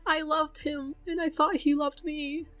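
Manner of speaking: hurt and crying as she speaks; pleading through tears to her viewers; tearful. Real, not acted. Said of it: "she broke into tears".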